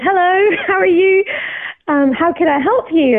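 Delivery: high-pitched, friendly pastor's hello; greeting pastor. Retold or imitated. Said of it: "the pastor turned around to me and said".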